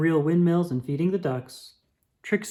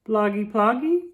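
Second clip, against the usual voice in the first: put-on baby babble; 6 semitones higher than usual. The baby asks Trixie in baby talk. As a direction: babble it like a toddler. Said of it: "asked the baby".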